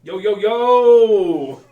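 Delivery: loud, slow drawn-out yo yo yo; hype greeting. Real, not acted. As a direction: greet the crowd big and stretched. Hype greeting opening the show.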